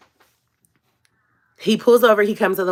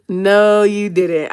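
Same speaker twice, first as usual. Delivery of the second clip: usual speaking voice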